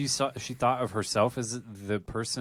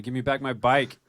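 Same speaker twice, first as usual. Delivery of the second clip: imitating an angry buddy's yell, louder and higher; mock shout at a friend, only half yelled; retold yell; 4 semitones higher, 6 dB louder than usual. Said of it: "he was, like, yelling at me".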